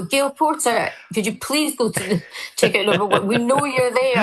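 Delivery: high, sing-song announcer voice; the store calling her out by name; mock tannoy call. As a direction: tease her name over the shop speaker. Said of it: "And they go".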